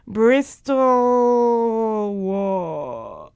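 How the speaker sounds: word stretches out as the pitch slides down; tape slowing and deepening. A narrator's reading. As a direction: run down like a dying tape. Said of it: "slowing and deepening".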